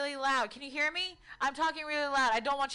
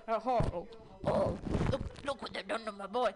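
lower, quicker mumbled Brando; retry the line lower, as if through mothballs; 4 semitones lower than usual. Retold or imitated. Comic redoing the Brando line, lower mumbled impression. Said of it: "I didn't have the mothballs in my mouth".

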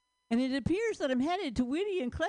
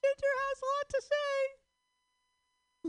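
very high, slow squeaky puppet voice; high stretched cartoon voice; squeaky slow character voice; Ginger's voice; much slower than usual. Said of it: "That's Ginger's voice".

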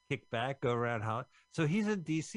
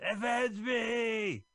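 slow, high hammy shout; overacted death scene; 10 semitones higher, 4 dB louder, much slower than usual. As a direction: yell it like a dying hero. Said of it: "He's like".

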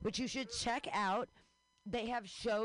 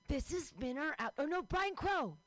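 normal talking voice

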